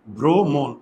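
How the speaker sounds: "ভ্রমণ" as bhro, mon, in two distinct syllables; both o's sounded. Shown as a demonstration. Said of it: "এই যে দেখো দুইটা ওকার".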